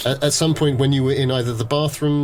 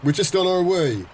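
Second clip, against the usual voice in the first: nervous little guy; 6 semitones higher than usual. Acted. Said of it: "This little guy gets out and goes".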